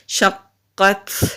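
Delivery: شقت ending with an audible puff of air on the ta; breathy final ta. Shown as a demonstration. Teacher demonstrates hams on the last letter. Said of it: "یہ جو اینڈ میں آواز آئی اس کو ہمس کہتے ہیں".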